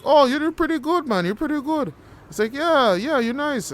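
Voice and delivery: squeaky, over-the-top instructor praise; high praising voice. Retold or imitated.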